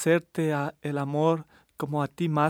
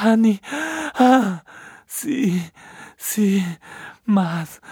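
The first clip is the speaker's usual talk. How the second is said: passionate urging; 3 semitones higher than usual. Acted. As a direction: cry out in rising passion. Lover urging climax, shouting.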